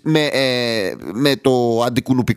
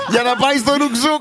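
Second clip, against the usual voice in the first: high, laughing voice; laughing while talking; 10 semitones higher than usual. Real, not acted. Finishing the anecdote for co-hosts, laughing. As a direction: get the words out through laughter.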